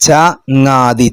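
ചങ്ങാതി drawn out syllable by syllable; slow syllables. Shown as a demonstration. Teacher shows pupils ചങ്ങാതി spelled out slowly. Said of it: "ചേർത്തി വായിക്കാനാ".

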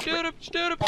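yelling furiously at an enemy; angry shout. Real, not acted. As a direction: scream it at the monster. Fighting a mob in game.

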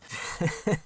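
soft unvoiced chuckle; breathy laugh. Real, not acted. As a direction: laugh quietly to yourself. Laughing at his own awkward phrasing.

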